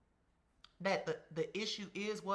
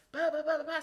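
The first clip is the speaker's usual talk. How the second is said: higher, louder mock babble for his rant; imitating a ranting man; 9 semitones higher, 8 dB louder than usual. Imitating Rocky blustering after the fight, higher and louder mock babble. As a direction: parody someone blustering in nonsense syllables. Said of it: "And Rocky is like".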